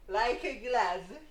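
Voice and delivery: slow, loud, high movie quote; dramatic catchphrase. Retold or imitated. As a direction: ham it up like a movie line.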